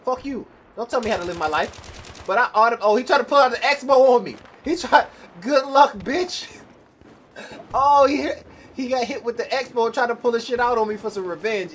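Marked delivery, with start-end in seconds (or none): 2.2-6.6 louder, higher, sudden gaming outburst; excited outburst. Real, not acted. Gamer yelling at an enemy who ambushed him, excitedly loud. 7.7-11.7 louder, higher burst of gaming excitement; excited outburst. Real, not acted. Gamer reacting to the enemy getting hit, loud and excited.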